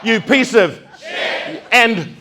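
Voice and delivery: high, loud furious yell, slow at end; mock furious shout; angry shout. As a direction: yell abuse at the officer. Retold shouting at officer.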